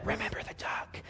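menacing unvoiced whisper. Sister whispering a threat to her brother as she passes. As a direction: hiss it under your breath as a threat.

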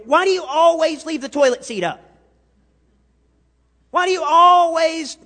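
hammed-up spouse griping about the toilet seat; exasperated complaint. Preacher imitating a nagging spouse to the congregation, loud and exasperated. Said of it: "your spouse gets mad at you".

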